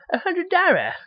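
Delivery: high-pitched mock Chinese accent; hammed-up, very high Chinese accent; Shitty Wok guy. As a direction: squeal it in a cartoon Chinese accent. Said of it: "in that stupid dollar fucking accent".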